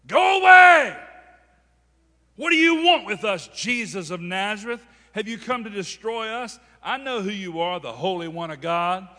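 demon-possessed man hollering at Jesus; crying out at top of voice. Acted. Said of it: "he's crying out to the top of his voice".

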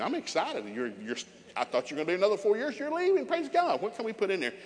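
high, gleeful hammed-up delight; mock excitement. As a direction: celebrate a child's leaving, voice soaring.

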